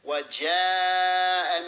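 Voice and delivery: "وَجَاءَ" with the long aa held for seconds; elongation. Shown as a demonstration. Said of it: "it's a long".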